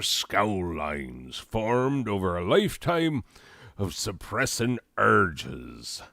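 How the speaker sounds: put-on swaying pirate-film accent; put-on pirate accent, lilting advice; Johnny Depp. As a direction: slide the pitch about in a comic pirate accent. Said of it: "how Johnny Depp talks in Pirates".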